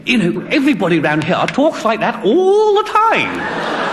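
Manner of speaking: high, drawn-out words through put-on laughter; imitated roaring laughter. DJ repeating the BBC joke, mock helpless laughing voice. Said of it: "he was roaring".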